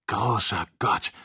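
slow, drawn-out exclamation; exclaiming. A narrator's reading. Joe spotting trouble at the balloon, exclaiming. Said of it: "rief Joe aus".

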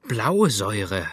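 slow, alarmed cry of recognition; startled exclamation. A narrator's reading. Doctor smelling poison in the room, alarmed cry. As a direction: sniffs and calls out the poison, slowed. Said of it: "rief der Doktor".

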